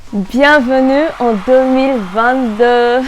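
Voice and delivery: loud, drawn-out new year greeting; big festive welcome. Real, not acted.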